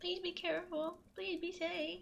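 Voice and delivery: hushed, worried plea; soft plea. Real, not acted. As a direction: gently urge them to stay safe. Pleading with viewer near storm.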